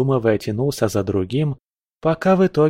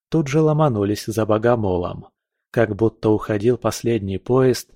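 plain reading voice

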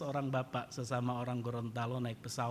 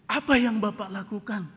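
mildly startled, higher and louder question; astonished; 7 semitones higher, 7 dB louder than usual. Retold or imitated. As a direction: ask in lightly acted amazement. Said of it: "pramugari ini kaget". (bertanya agak kaget)

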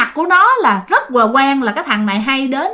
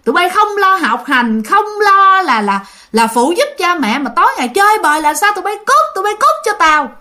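grandfather yelling; 6 semitones higher than usual. Retold or imitated. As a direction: chase the kids away, voice raised high. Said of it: "mà la vậy".

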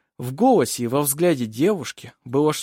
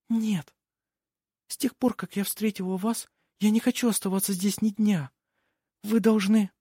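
put-on pleading young girl; 9 semitones higher than usual. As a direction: implore him in a high, tender voice. Nikola confesses and pleads.

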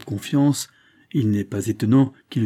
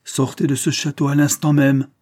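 stern, louder, higher command; sharp order rising in force; severe; 5 semitones higher, 5 dB louder than usual. A narrator's reading. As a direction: order him out with royal severity. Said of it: "ajouta-t-il d'un ton sévère".

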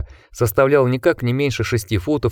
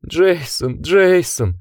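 высокие рыдающие выкрики старика; рыдать от благоговения; рыдая; 8 semitones higher, much slower than usual. A narrator's reading. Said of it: "зарыдал старик". (старик рыдает у ног Джека)

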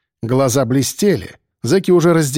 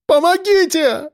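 screaming for help; slower than usual. A narrator's reading. Лиза calling for help.

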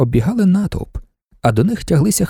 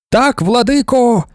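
high, slow fervent reply; women shouting; 8 semitones higher, 3 dB louder, slower than usual. A narrator's reading. Women answer their lord in a high, drawn-out shout. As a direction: shout back in devoted unison. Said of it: "закричали жінки".

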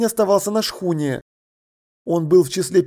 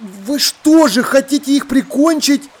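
high, louder shocked question; horrified bewilderment; 8 semitones higher, 4 dB louder than usual. Acted. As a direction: ask in dismay, pitch leaping up. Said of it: "отразились ужас и недоумение".

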